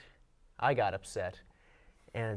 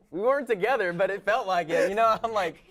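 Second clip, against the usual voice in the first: laughing; 8 semitones higher, 5 dB louder than usual. Real, not acted. Laughing at own heartbreak story.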